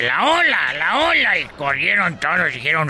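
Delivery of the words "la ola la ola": high-pitched mock warning call; retold shout. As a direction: call it out again as a hammed-up alarm. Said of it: "El otro día grité".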